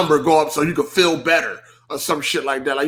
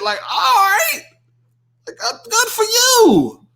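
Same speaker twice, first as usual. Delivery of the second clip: sarcastic mock cheer; 13 semitones higher, 3 dB louder than usual. Sarcastic reply to a tweet.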